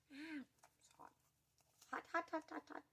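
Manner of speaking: high pained yelps at the heat; pained little cries, burning fingers; yelping in pain. Real, not acted. Burning fingers tearing it open.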